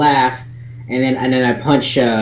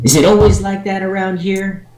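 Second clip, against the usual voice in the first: hard of hearing near-yell; 8 semitones higher than usual. Acted. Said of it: "practically yelling at the guy".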